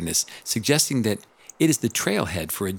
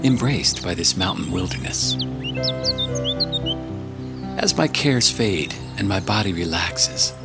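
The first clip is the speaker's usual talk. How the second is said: audiobook narration. Acted. Reads audiobook chapter.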